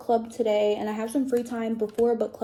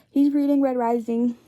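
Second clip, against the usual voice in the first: wearing a retainer; 2 semitones higher, 7 dB louder than usual. Real, not acted. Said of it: "I have my retainers in".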